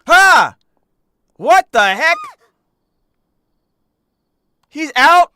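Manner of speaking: shocked shout. Real, not acted. Streamer seeing the villain free, shocked shout. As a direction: yell in real disbelief. Villain suddenly appears on screen.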